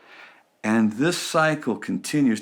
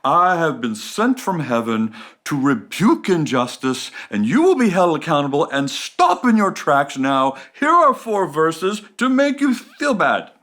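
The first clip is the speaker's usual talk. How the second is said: louder, indignant rescuer rebuking the bad guy; self-righteous rescuer; 3 dB louder than usual. Speaker mocking the rescuer scolding the bad guy, loud and pompous. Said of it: "shows up and says to the bad guy".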